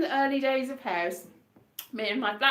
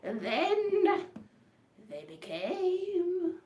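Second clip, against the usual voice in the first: horror narrator voice; 5 semitones higher, 5 dB quieter, much slower than usual. Pretend. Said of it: "sort of narrator to a horror show".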